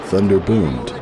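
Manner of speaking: usual speaking voice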